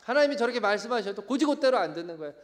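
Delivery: ordinary talk, nothing marked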